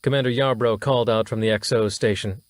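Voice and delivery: ordinary delivery, nothing marked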